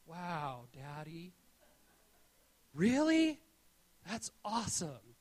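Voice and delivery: put-on hushed little-boy wonder; imitating a toddler. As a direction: marvel like a three-year-old. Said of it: "he just looked at me and went".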